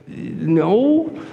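high, timid, rising "no"; cowering in fear. Acted. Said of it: "you're just kind of cower in fear".

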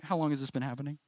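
soft, casual question with a laugh; chill. Acted. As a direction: toss it out quietly, totally unbothered. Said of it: "It's just so chill".

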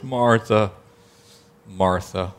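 soft, gentle, calling her name twice; tender. Acted. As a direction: say her name with loving concern. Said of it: "notice the tenderness, the gentleness, the meekness".

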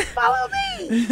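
eerie high voice. Shown as a demonstration. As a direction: stretch "Follow me." high and slow like a haunting call. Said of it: "Well, or".

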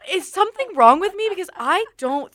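high, loud, mock distressed; hammed-up worried whine; exaggerated alarm. Real, not acted. Mock panic about herself.

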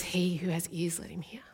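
soft whispered quote; whispered scripture. Real, not acted. Preacher quoting Jesus to the church, whispered. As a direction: breathe the verse in a whisper.

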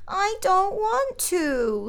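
put-on high, slow sing-song child's refusal; drawn-out, high sing-song voice. A narrator's reading. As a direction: chant it like a defiant toddler. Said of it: "in a sing-song voice".

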